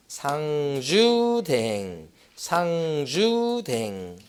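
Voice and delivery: very slow, stretched syllables; drawn-out memory drill. Shown as a demonstration. Instructor drilling a mnemonic into exam students, drawn-out. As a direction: stretch the keyword out like a chant.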